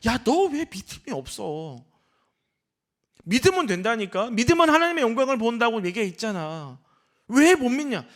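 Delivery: mildly exasperated, pressing the listener to believe; imitated rebuke. Acting out Jesus rebuking the unbelieving.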